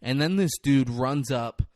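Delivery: normal talking voice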